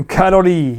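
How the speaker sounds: "Calorie" with a tapped middle, kad-o-dee; "Calorie" said slightly like cad-oddy; accented pronunciation. Shown as a demonstration. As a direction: draw out "Calorie" carefully, ending on a long ee. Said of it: "It's just my accent".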